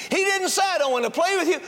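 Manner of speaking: fervent sermon push; preaching. Acted. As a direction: urge the congregation to act. Preacher's exhortation.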